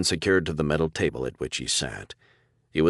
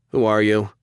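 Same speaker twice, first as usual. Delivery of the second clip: sharper and louder, a little higher; a tad impatient; 5 semitones higher, 6 dB louder than usual. Acted. Prisoner demanding the stranger's name, impatient. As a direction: push for an answer, short on patience. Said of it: "becoming a tad impatient".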